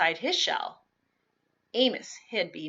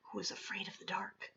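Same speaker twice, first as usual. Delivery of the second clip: whisper; 11 dB quieter than usual. A narrator's reading. Reading story, whispered aside.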